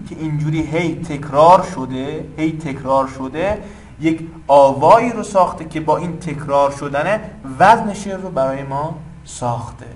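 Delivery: normal talking voice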